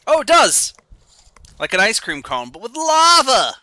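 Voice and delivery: spotting the magma cone level, in loud delighted excitement; excited. Real, not acted.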